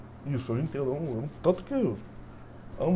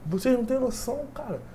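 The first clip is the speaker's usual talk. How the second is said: retold angry retort; 8 semitones higher than usual. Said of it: "Eu falei".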